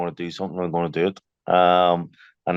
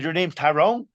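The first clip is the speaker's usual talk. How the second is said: high-pitched incredulous question; imitated disbelief; 10 semitones higher than usual. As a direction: imitate an astonished man checking a name. Retold disbelief at his name.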